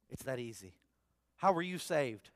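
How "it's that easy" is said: much softer and lower, almost confiding. Real, not acted. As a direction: drop the voice to let it land. Preacher stressing simplicity.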